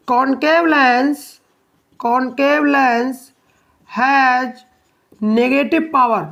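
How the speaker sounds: slow dictation in short, separated chunks. Real, not acted. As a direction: give each phrase time to be written down. Dictating the second note point. (धीरे-धीरे, रुक कर लिखवाते हुए)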